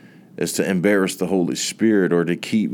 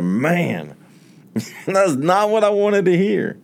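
rueful laugh; 10 semitones higher than usual. Retold or imitated. His own dismayed reaction retold.

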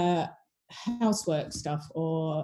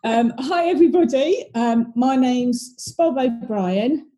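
stifled giggles; 6 semitones higher, 7 dB louder than usual. Real, not acted. Giggly intro after laughing fit.

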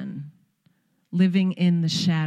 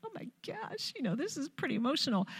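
high, breathy-soft and choked up, fast; a mother overwhelmed at her daughter's wedding; emotional mother; 8 semitones higher, 12 dB quieter, faster than usual. Retold or imitated. Mother at daughter's wedding, softly choked up. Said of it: "a little bit like".

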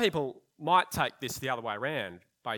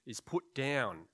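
ordinary talk, nothing marked

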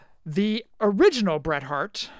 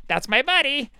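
higher, hammed-up Kramer-style line; Kramer impression; 8 semitones higher, 3 dB louder than usual. Joking with co-host, a light Kramer impression. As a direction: lightly mimic Kramer's excited greeting. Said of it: "That's my friend Kramer".

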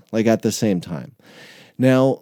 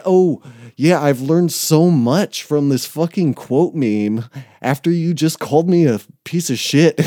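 sarcastic mock reply; 5 semitones higher, 5 dB louder than usual. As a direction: pretend to be grateful, dripping with sarcasm. Sarcastic mock reply to her meme.

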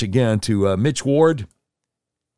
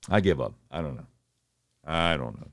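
resigned mumble; 8 semitones lower, 8 dB quieter than usual. Real, not acted. Giving up, fading voice.

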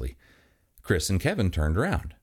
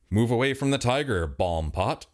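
ordinary delivery, nothing marked

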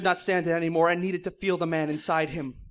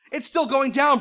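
higher, louder alarmed cry; 6 semitones higher, 4 dB louder than usual. Acted. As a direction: call out the news in a rush. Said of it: "Brody cried".

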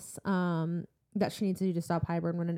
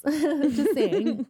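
laughing while talking; 7 semitones higher, 5 dB louder than usual. Real, not acted. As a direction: say it through a laugh. Laughing aside.

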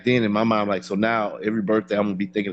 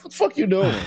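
high, outraged question; shocked disbelief; 10 semitones higher than usual. Retold or imitated. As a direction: react like he's lost it. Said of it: "I was like".

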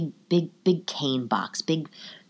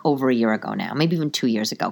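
usual speaking voice

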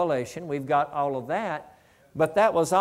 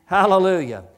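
louder, falling hallelujah shout; 7 dB louder than usual. Real, not acted. Preacher exclaims to the congregation, loud. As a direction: call it out to the room.

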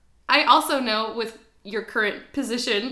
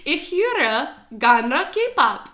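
very slow German, höre drawn out; slow teaching demo; much slower than usual. Shown as a demonstration. Teacher slowly modelling a German phrase for her friend. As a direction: spell out "Ich höre gerne K-pop" word by word in German. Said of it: "The next one".

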